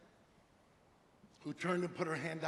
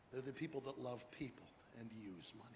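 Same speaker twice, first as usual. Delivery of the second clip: ordinary talk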